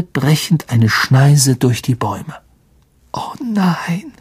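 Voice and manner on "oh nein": high, breathy dread; soft, high whimper of alarm; frightened. A narrator's reading. Ron as something big approaches, frightened.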